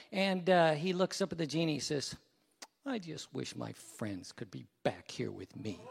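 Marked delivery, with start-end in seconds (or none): 2.9-5.8 low, soft, pitiful punchline; forlorn last castaway. Acted. Lonely last castaway to the genie, forlorn. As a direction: say it small and low, mock-sad.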